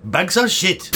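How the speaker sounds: bad comic Welsh lilt; Welsh accent. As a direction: do a contentious, cartoonish Welsh accent. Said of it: "Can you do a Welsh accent?".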